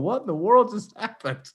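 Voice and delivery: high stunned question. Retold or imitated. Host imagining the stunned wrestler, mock bewildered. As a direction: sound dazed and lost. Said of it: "the most stunned person in the room going".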